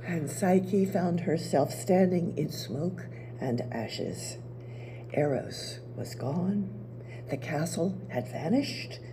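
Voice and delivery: soft, low, grieving storytelling; somber soft narration. A narrator's reading. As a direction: let it sink into ashes and silence. Narrator drops low and sad.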